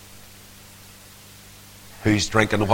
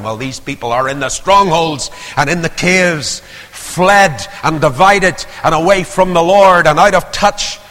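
louder, higher impassioned preaching; preaching at full force; 4 semitones higher, 4 dB louder than usual. Acted. Preacher calling backsliders home, impassioned and loud. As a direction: plead with the cold-hearted, building loud.

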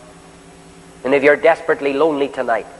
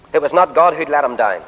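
ordinary delivery

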